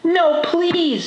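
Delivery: high-pitched protest; pleading boy. A narrator's reading. Arthur refusing Mom's help, high pleading. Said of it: "said Arthur".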